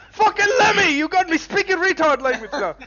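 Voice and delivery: high, loud, comic pilot grumble; annoyed pilot. Acted. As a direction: squawk it as a rattled pilot. NPC pilot grumbling mid-laugh.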